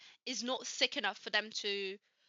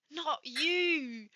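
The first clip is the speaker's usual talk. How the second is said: higher, drawn-out inward protest; mock hurt; 4 semitones higher than usual. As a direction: act out a wounded plea. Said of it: "I was just so hurt".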